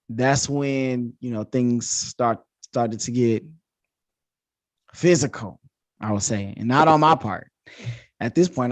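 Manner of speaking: ordinary delivery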